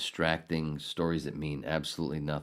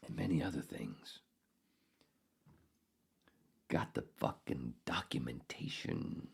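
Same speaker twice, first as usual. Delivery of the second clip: whisper; 6 semitones lower, 12 dB quieter than usual. Real, not acted. Whispered aside.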